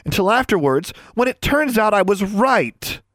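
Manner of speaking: high, indignant, slowing to a point; fuming. A narrator's reading. Anna insisting she is proved right, fuming. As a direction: drive the grievance home. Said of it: "Anna fumed".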